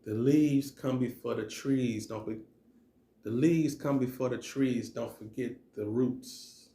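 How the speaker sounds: measured, emphatic spoken-word recital; spoken-word poem. Acted. Poet performing own motivational piece for listeners, rhythmic spoken-word delivery. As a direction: recite it in rhythmic phrases, drawing out the line endings.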